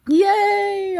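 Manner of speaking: bright high cheer of delight; happy cheer. Real, not acted. Streamer cheers after getting the word right, delighted. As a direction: cheer the win out loud.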